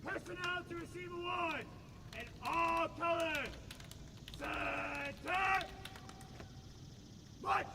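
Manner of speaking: slow, high, drawn-out parade call; drill command. Real, not acted. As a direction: sing out the order across the field. Military drill commands at ceremony.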